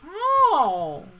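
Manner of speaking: very high, loud cry; mock scream. Imitating the critic condemned by Satan, high mock scream. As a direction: wail it out, hammed-up.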